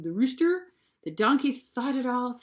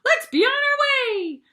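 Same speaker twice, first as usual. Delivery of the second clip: much higher, loud rooster cry; 7 dB louder than usual. A narrator's reading. The rooster urging the band onward, high and loud. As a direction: sing it out like an eager rooster. Rooster voiced after crow.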